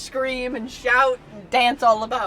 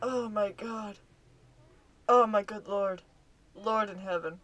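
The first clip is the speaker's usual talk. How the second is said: hushed awe; 4 semitones lower, 10 dB quieter than usual. Real, not acted. Calming down after the reveal.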